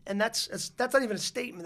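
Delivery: ordinary talk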